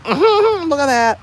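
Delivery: high, loud laughing delight; gleeful laughing. Real, not acted. Streamer seeing dollar tips come in, laughing gleefully. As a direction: crow over it through a high laugh.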